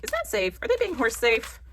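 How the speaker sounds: high-pitched hammed-up worry; squeaky mock-anxious questioning; mock alarm. Acts out own worry about horses.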